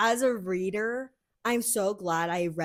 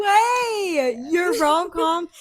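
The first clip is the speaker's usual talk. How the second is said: No way, shouted high and loud in delight; excited shout; 10 semitones higher, 8 dB louder, much slower than usual. Real, not acted. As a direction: yell it out, thrilled. Hearing about the rom-com.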